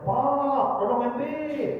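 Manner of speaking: high voice calling across the house. Retold or imitated. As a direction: call out to the husband from afar. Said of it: "Itu tak berakal". (memanggil dengan suara tinggi)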